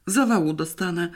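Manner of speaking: usual speaking voice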